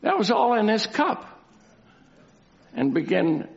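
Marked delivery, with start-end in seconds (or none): none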